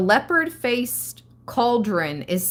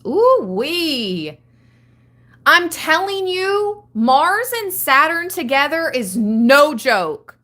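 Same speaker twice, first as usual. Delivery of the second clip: higher and louder, fired up and emphatic; hyped up; 5 semitones higher, 5 dB louder than usual. Real, not acted. Tarot reader hyping viewers about Mars and Saturn: loud, high, excited. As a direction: preach it like it's no joke.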